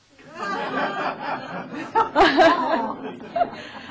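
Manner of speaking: big laugh; loud high laughter. Real, not acted. Actress laughing after asking who's interested, loud laugh.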